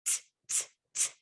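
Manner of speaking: "T" as a voiceless tick, not the letter name; quiet, separate bursts of "t"; isolated T sound. Shown as a demonstration. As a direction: tap out "t" softly with no vowel. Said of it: "give me the sound and not the letter".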